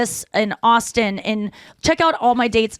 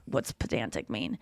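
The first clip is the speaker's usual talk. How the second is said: much lower and softer, sheepish; mock clueless question; 11 semitones lower, 13 dB quieter than usual. Friend admitting ignorance, quietly clueless. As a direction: ask it low and small like you never knew.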